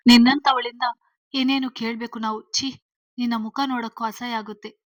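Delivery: loud bursts of angry reproach; heated scolding, forceful on the openings. A narrator's reading. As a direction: lash out at a daughter in disgust. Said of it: "ಎಂದರು ಶ್ರೀಧರ್ ಸಿಟ್ಟಿನಿಂದ".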